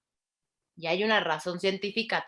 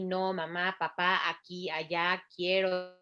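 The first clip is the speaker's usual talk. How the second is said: ordinary talk, nothing marked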